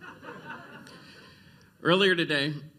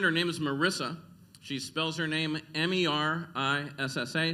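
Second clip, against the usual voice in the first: ordinary delivery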